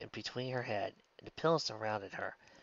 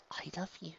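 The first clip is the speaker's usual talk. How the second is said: high, soft muttered words; 7 semitones higher, 8 dB quieter than usual. A narrator's reading. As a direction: murmur it faintly, nearly gone. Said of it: "she muttered".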